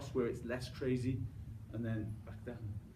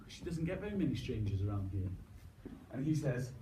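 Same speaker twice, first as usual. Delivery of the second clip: ordinary delivery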